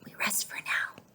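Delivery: hushed, breathed with no voice; whisper. A narrator's reading. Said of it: "whispered Jose".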